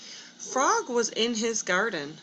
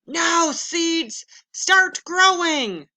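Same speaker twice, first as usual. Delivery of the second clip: loud, higher, slow command to the seeds; 8 semitones higher, 8 dB louder, slower than usual. Acted. Toad orders his seeds loudly to grow. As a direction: bellow it slowly at the ground. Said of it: "said loudly".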